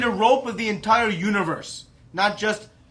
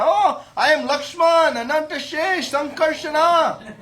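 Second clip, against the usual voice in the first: high, loud self-proclamation; grand boast; 10 semitones higher, 6 dB louder than usual. Acted. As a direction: announce your titles with hammed-up bravado. Balram boastfully declares his divine names.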